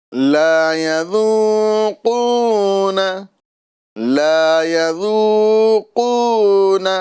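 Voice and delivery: slow, melodic tajweed chant; Quranic recitation. Acted. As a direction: recite it measured and drawn out. Recites verse.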